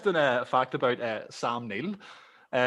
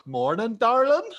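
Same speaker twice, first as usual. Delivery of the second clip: imitating a greeting with "darling" leaping up high; "darling" pitched high as a question; mock greeting, sharp upward lift on "darling"; question on the end; 8 semitones higher, slower than usual. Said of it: "with a question on the end".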